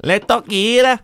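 put-on high, loud posh London accent on "La Taquera"; mock London accent. Friend teases his pronunciation, an exaggerated London accent. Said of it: "You sound like you're from London".

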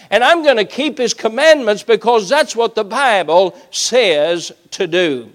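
loud, high, driving pulpit voice; full-force preaching. Real, not acted. Preacher telling why Paul gave all, impassioned loud preaching. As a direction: build a forceful sermon climax and drive it home.

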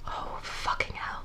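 slow exasperated whisper; whispered exasperation. Real, not acted. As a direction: breathe it out in disbelief. Exasperated reaction to pasta correction.

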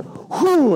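high loud mock whoosh of breath; mock blowing whoosh. Pastor mocking holy laughter preachers, high loud whoosh. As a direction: blow it out like a stage healer. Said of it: "where they go".

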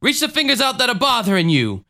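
high, dramatic preacher command; Jim Jones. Acted. Faith healer commands a woman from the pulpit, dramatic preacher voice. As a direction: act out a showy faith healer. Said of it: "he does this, like, dramatic".

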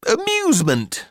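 high-pitched, coaxing AMUSEMENT; mother's airy, high suggestion; hopeful mother. A narrator's reading. Mother offers the word brightly.

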